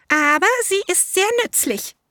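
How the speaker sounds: high, eager little elephant voice; elephant child. A narrator's reading. Elephant child answers the python in a high childlike voice.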